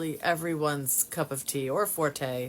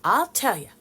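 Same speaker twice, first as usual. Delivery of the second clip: imitating a Texas accent, louder and higher; 5 semitones higher, 6 dB louder than usual. Texan cousin answering how she slept, imitated Texas accent. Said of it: "she's saying it in her Texas accent".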